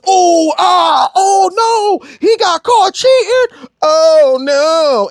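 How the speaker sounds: high shrieking mock-shocked gasps; Kevin Hart impression. Mocking the gossiping crowd, high shrieking Kevin Hart squeals. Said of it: "Everybody doing the fucking Kevin Hart".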